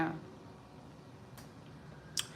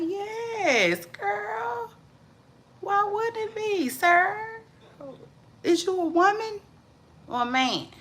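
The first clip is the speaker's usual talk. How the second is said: much higher, put-on squeaky-high reply; playful high voice; 14 semitones higher, slower than usual. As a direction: pitch it way up, teasing a commenter.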